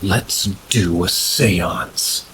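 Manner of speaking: slow, drawn-out proposal in a put-on voice; friend's suggestion. Friend proposing a seance to the group, slow put-on voice. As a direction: stretch out each word like a dare. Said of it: "my friend goes".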